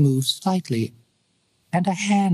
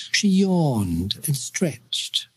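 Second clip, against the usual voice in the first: usual speaking voice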